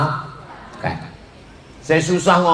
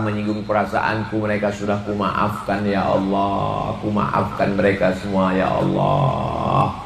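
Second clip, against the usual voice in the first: prayer; 7 semitones lower than usual. Acted. Modelled prayer of forgiveness. (doa yang pelan dan khusyuk)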